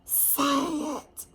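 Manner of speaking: soft, hissing urgent prompt; hissed. Acted. Angel prompting Frances on stage, hissed softly. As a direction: hiss it under the breath.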